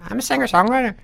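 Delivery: comically high, small Irishman; little boy-like voice beside a big man; tiny high voice. Retold or imitated. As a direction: squeak it up high, self-mocking. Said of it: "my voice sounded like".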